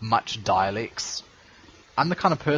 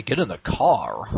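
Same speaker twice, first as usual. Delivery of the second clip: New Zealand "Get in the car" with a rolled-off /ɹ/ in "car"; a lightly done Kiwi accent; 3 semitones lower than usual. Shown as a demonstration. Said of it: "Kiwis say".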